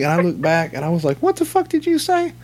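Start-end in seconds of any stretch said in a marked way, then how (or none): 1.2-2.3 shocked. Retold or imitated. Retold shocked reaction in car.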